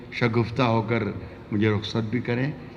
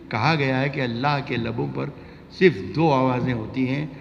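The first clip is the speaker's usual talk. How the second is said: normal talking voice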